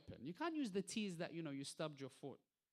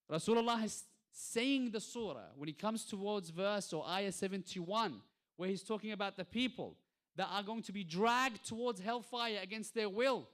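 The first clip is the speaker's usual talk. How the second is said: raised voice, loud and insistent; forceful preaching; 3 semitones higher, 6 dB louder than usual. Real, not acted. Preacher recounting a verse on hellfire, forceful preaching. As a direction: preach it out to the whole hall.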